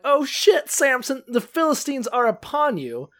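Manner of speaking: high-pitched urgent warning cry; hammed-up panicked warning; mock alarm. Delilah runs to warn Samson, acted.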